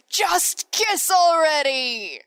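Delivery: high, drawn-out mock shout; fandom shouting. Fandom shouting at two flirting characters, mock-exasperated. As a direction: cry it out slowly like an exasperated fan. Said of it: "hear the fandom shouting collectively".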